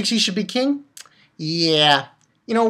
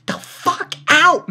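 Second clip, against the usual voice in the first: loud dismissal; 9 semitones higher, 4 dB louder, much slower than usual. Real, not acted. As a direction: get the fuck out, higher, louder and slow. Ranting at character Cohen.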